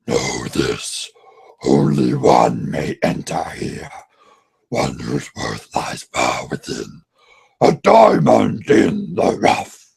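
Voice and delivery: low, slow giant cave voice; booming cave voice. Retold or imitated. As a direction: rumble it like an ancient cave. Cave guardian speaks.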